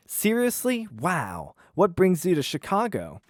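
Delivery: a starstruck waitress meeting a president; Delaney's voice. Acted. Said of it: "I'll do the voices just 'cause it's fun".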